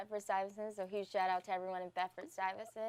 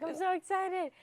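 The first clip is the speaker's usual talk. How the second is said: retold excitement; 11 semitones higher, 6 dB louder than usual. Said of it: "I was like".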